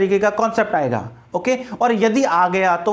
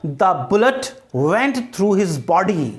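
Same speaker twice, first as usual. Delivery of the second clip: slow, deliberate English for students; slow example sentence; much slower than usual. Shown as a demonstration. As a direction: read the example out slowly in small chunks. Teacher models example sentence.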